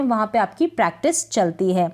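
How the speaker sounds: ordinary delivery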